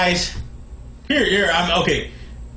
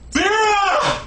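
calling out; 3 semitones higher than usual. Acted. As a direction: holler the name urgently to someone away. Calling out for aspirin.

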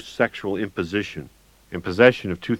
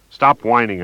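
loud, sharp snap; loud impatient snap at the whining man; snapped; 4 semitones higher, 8 dB louder than usual. Acted. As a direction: cut him off hard. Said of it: "I snapped".

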